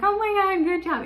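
high, loud, thrilled cheer; screaming praise. Retold or imitated. Mom cheering her toddler's first right answer, shrieking with joy. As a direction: throw him a little party with your voice. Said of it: "screamed and yelled".

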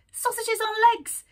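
"Sausages on legs" high and loud in a mocking rat voice; scornful rat voice. Rat scoffs at lizards.

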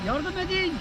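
high, hammed-up call for help; mock cry for help. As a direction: fake a panicked plea, high. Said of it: "dermişim". (yalandan yüksek sesle yardım çağrısı)

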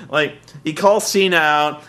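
ordinary talk, nothing marked

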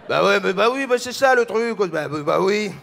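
animated mock French chatter; cliché Frenchman babbling. Frenchmen alone as foreigners imagine them, animated mock babble. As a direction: rattle out French filler as a caricature. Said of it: "doing what you think we do".

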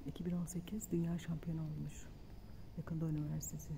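hushed library whisper. Real, not acted. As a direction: keep it quiet in the library. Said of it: "sessiz olacağız".

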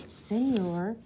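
usual speaking voice

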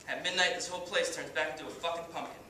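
cocky washed-up pitcher, swaggering speech; brash halftime pep speech, full of bravado; Kenny Powers. Acted. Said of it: "as Kenny Powers".

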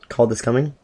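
ordinary talk, nothing marked

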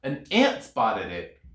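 ordinary delivery

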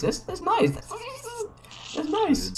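high squeaky "that's nice"; a tiny high-pitched mock compliment; mock squeaky approval. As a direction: squeak it out like a cartoon. Squeaky reaction to bank price.

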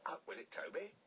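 high, impatient demand; impatient Thomas breaking in; impatient interruption. A narrator's reading. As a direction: cut in, pressing for the news. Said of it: "Thomas interrupted".

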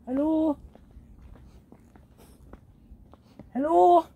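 loud high call into the dark. Real, not acted. Calling out to someone in the dark graveyard, loud. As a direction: shout hello into the darkness.

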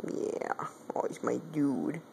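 soft whispered affection, rising on dude; tender cat whisper. Real, not acted. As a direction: breathe it to the cat. Cooing to the cat.

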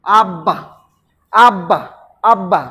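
আব্বা as আব-বা, with a doubled ব; two-syllable pronunciation. Shown as a demonstration. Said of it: "উচ্চারণ করলে দুইটা অক্ষর". (আব্বা, দুই অক্ষরে আব-বা)

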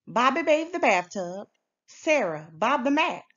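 ordinary delivery, nothing marked

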